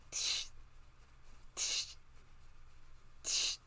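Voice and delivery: quiet monkey tsh-tsh back; soft tsh tsh monkey chatter. A narrator's reading. Monkeys mocking the peddler back, soft tsh sounds.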